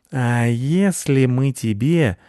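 wheedling, drawn-out offer; insinuating. A narrator's reading. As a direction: draw it out, sidling toward a bribe. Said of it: "начал вкратчиво Рыжий".

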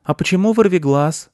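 put-on high, curious kid's question; boy asking. A narrator's reading. Boy asks the squirrel about her name, curious. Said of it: "спросил мальчик".

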